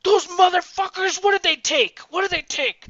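high, loud enraged outburst; acted-out furious yelling; going ballistic. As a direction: explode like he's lost it, imitating. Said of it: "he just goes ballistic".

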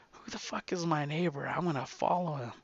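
hushed, furtive muttering; very soft, secretive aside; sneaky spy voice. Acted. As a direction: drop way down as if plotting to snoop. Bored man decides to spy.

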